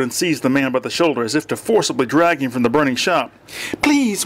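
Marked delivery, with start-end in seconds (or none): 3.8-4.2 high-pitched desperate plea; begging. A narrator's reading. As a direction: plead as a desperate daughter. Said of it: "one of the daughters begged".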